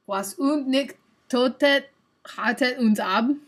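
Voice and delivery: loud, slow, clumsy German; butchered German. Shown as a demonstration. Streamer reading the chat's translation, in butchered German. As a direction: sound out the German haltingly and loudly. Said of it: "butcher the German for you".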